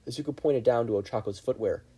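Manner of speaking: ordinary delivery, nothing marked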